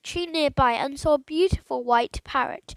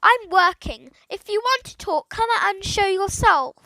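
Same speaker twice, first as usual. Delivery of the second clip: loud, higher shout; 6 semitones higher, 4 dB louder than usual. A narrator's reading. Boy shouting at the hidden voice, loud. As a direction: call out across the hillside. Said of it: "He shouted again".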